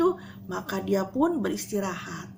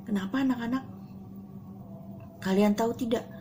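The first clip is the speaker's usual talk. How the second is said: normal talking voice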